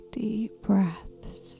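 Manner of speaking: ordinary talk, nothing marked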